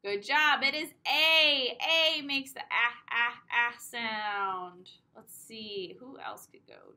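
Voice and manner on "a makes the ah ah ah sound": ah, ah, ah drawn out slowly; sounding out short a. Shown as a demonstration. Phonics teacher to preschoolers, drawn out. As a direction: linger on each ah. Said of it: "teacher sounding vowel".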